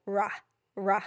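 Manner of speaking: "ra" said as a short clipped r plus ah; isolated ra sound. Shown as a demonstration. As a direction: isolate the "ra" at the front of ruff. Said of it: "Listen to the first part of that sound".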